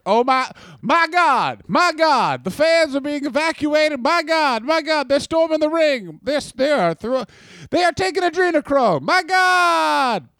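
high, hammed-up ringside alarm; aghast play-by-play call; panicked wrestling commentator. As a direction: cry out in disbelief over the chaos.

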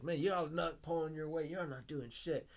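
high, berating trash talk; mock-indignant complaining gamer; ripping on teammates. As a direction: rag on the whole team. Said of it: "he's just ripping on everybody else".

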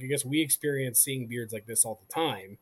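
normal talking voice

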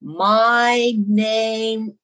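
very slow, drawn-out words, one at a time; painfully slow imitation; slow, stretched-out speech. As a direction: stretch every word as if addressing someone who barely understands. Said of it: "he stoops down low and he goes".

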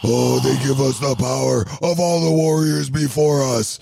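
slow, drawn-out wrestling promo; Ultimate Warrior promo. Pretend. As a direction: cut it like a wrestler hyping the crowd. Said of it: "You gotta cut a promo on that".

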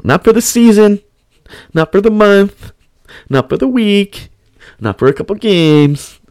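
high-pitched mock manager listing; string out each denial in a put-on high voice; teasing build-up. Manager ruling out longer periods.

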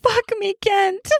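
high, breathy begging plea; whispered plea. Acted. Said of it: "She whispers through the fabric".

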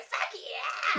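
high mock shout; drunken cheer. Bartender imitates drunk customer accepting a shot, rowdy yell.

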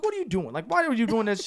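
high, exasperated questioning; pissed off. Retold or imitated. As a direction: re-enact confronting her in annoyance. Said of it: "So I'm pissed".

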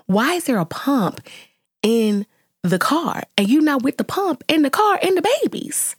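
high, sharp incredulous telling-off; indignant scolding. Retold or imitated. Retelling her lecture to the careless mom, indignant and high. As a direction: scold her like she lost her mind.

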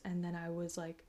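normal talking voice

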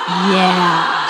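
laughing. Real, not acted. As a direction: break into a gleeful laugh. Laughing at universe's gift.

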